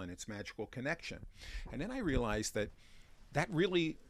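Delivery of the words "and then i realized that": usual speaking voice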